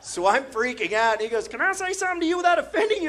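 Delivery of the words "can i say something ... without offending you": fast, high put-on voice; imitated stranger. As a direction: mimic the stranger in a rapid high pitch.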